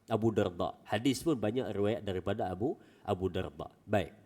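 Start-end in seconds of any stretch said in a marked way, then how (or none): none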